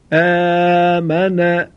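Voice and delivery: آمن with the آ stretched slowly; drawn-out long aa. Shown as a demonstration. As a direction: hold the opening آ of آمن long, like a slow fatha. (آ در آمن را کشیده و آهسته بخوان)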